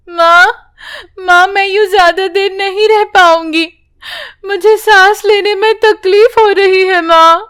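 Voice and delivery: put-on a girl gasping that she can't hold on; distressed child. Priya struggling in water. (ऊँची, घबराई बच्ची की गुहार)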